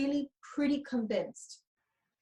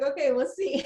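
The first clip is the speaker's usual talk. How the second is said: lightly voiced skeptical shrug, higher and laughing; Jen impersonation; 5 semitones higher, 4 dB louder than usual. Host teasingly imitates client Jen's old doubt, a light laughing skeptical voice. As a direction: mimic her doubtful "we'll see" with a chuckle. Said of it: "I'm gonna do my Jen impersonation".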